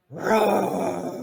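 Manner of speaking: loud mock lion roar. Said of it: "Probably not the best roar".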